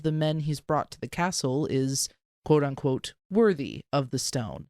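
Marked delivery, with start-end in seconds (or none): none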